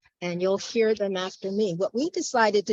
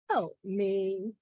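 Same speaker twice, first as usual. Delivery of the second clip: softened plea; 5 dB quieter than usual. Acted. Poet repeating the plea for help, hushed. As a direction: let "help me" drop to a quiet appeal.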